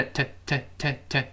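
"t" as a short, light "tuh", repeated slowly; weakened vowel. Shown as a demonstration. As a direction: reduce "to" to a quick "t" with schwa. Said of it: "还是元音弱化". (元音弱化，轻短地读"t")

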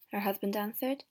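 usual speaking voice

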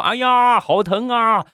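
high wail of labour pain; hammed-up, high-pitched "it hurts" cry; mock crying. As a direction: imitate a woman wailing in pain. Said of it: "一直在那儿哭啊".

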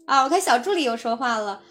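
ordinary delivery

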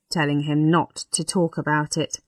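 ordinary delivery, nothing marked

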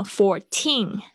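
put-on slow "fourteen" with a strong aspirated "teen"; stressed TEEN. Shown as a demonstration. Teacher contrasting it with forty, end-stressed "fourteen". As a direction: hold back, then hit "teen" in "fourteen". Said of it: "就是有重音".

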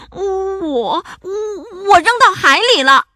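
stammered start, then high, loud frightened blurt; trembling. A narrator's reading. Monster confessing to angry pirates, trembling. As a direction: stutter it out in fright. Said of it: "丑妖怪颤抖地说".

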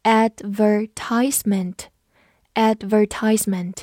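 "advertisement" with "tise" rhyming with "ice"; American pronunciation. Shown as a demonstration. Teacher showing learners the American "advertisement", AD-ver-TISE-ment. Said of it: "美式发音和它差异比较大".

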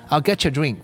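"get you" run together as getcha; linked getcha. Shown as a demonstration. Teacher gives learners an example, linked getcha. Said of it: "又读成".

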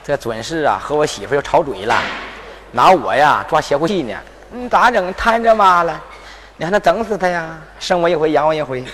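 low, soft aside to himself; muttered aside. Acted. Son muttering to himself about his mother and wife. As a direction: mutter it to yourself.

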